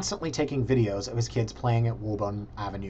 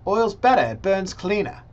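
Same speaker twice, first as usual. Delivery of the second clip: higher-pitched imitation British accent; raised, put-on British voice; 7 semitones higher, 3 dB louder than usual. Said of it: "That was my British accent".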